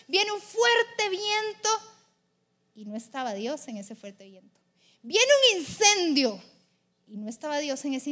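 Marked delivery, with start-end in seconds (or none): 0.1-1.7 high, loud and stretched out; big dramatic swell; booming narration. A narrator's reading. Dramatic telling of the mighty wind. 2.7-4.6 lower, falling narration; subdued after the big swell; hushed anticlimax. A narrator's reading. Anticlimax after the mighty wind. 5.0-6.4 loud, high and drawn out; big booming announcement; dramatic proclamation. A narrator's reading. Dramatic telling of the fire.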